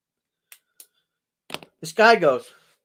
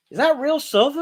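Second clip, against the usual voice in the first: mock clerk voice; 12 semitones higher, 5 dB louder than usual. Clerk voiced in a retold story.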